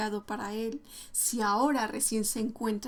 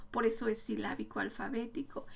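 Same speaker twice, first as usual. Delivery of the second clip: ordinary talk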